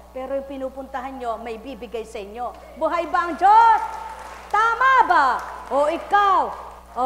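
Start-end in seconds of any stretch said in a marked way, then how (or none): 2.7-7.1 loud, high fiery preaching; preaching at full force. Acted. Preacher calling out to the congregation, fiery and loud. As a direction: call it out to the crowd.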